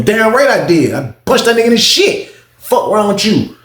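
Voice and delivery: high, heated retort, retold; defiant retort. Defiant answer in retold quarrel.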